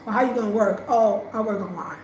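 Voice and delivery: ordinary talk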